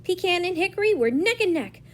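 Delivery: plain reading voice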